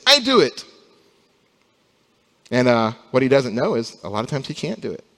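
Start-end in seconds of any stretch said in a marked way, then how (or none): 0.1-0.6 put-on much higher, louder little-child voice; toddler insisting. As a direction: play the stubborn two-year-old demanding. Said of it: "Whatever it is".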